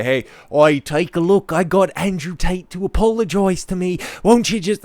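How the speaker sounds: high-pitched mock Australian accent; bad Australian accent, parodying a British interviewer; botched Australian accent. Streamer playing the boastful interviewer to his audience, botched Australian accent. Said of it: "I'm doing an Australian accent".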